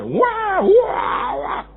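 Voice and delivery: put-on high child wail; mock a child's screaming tantrum; anak merengek. Anak menangis. (tangisan anak yang dibuat-buat, melengking tinggi)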